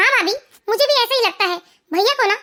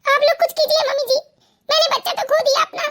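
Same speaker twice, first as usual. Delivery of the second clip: weeping, pitched-up plea; crying; 3 semitones higher than usual. Acted. Wife begging mother-in-law to save her marriage, crying. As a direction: beg for help through sobs. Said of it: "रोने धोने की जरूरत नहीं है".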